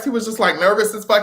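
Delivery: ordinary talk, nothing marked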